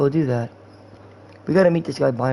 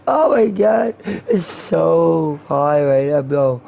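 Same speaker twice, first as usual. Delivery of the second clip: stoned guy. Pretend. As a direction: act high, dragging words and breaking into laughs. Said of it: "That's how you act when you're high".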